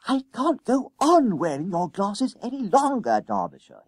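high, complaining schoolboy voice; Jennings, fed up. A narrator's reading. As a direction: protest like a fed-up boy, pitched high.

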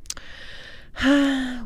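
long breathy sigh; slow exhaled sigh; audible sigh. A narrator's reading. Mother sighs before summing up.

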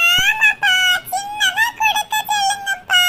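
put-on high, slow child voice asking dad; a little kid backing up a sibling; child asking father for smaller homework; pleading child. Second child chimes in.

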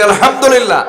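loud, high, ringing alhamdulillah. Shown as a demonstration. Preacher leads the audience, shouting alhamdulillah. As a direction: lift it up to the whole crowd. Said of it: "জোরে বলি".

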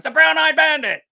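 high, loud triumphant announcement; gleeful shout. Retold or imitated. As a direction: belt it out like a proud prankster. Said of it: "and he goes".

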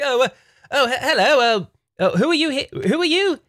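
high, flustered British toff as Nintendo; British accent. Pretend. Nintendo surprised in its money vault, British accent. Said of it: "Nintendo has a British accent".

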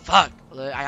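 normal talking voice

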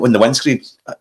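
usual speaking voice

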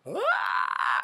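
high-pitched squeal of delight; real shriek of excitement; excited squeal. Real, not acted.

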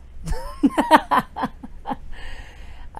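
high-pitched burst of laughter. Real, not acted. Laughs at own clumsy phrase.

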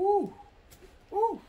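high, loud ooh of pain; pained yelp. Real, not acted. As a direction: yelp as the hair is ripped. Hair ripped off during sugaring.

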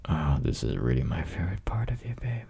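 put-on breathy close-mic whisper to a girlfriend; teasing boyfriend whisper, close and intimate. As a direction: whisper it right into her ear.

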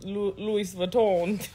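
normal talking voice

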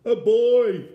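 very loud, high yell; full-force cry of alarm. A narrator's reading. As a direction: yell it as a startled bear. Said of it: "yelled the bear".

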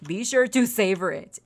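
normal talking voice

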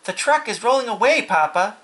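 high, urgent warning; child's alarm. Acted. Fern warning Papa of the truck, high alarm.

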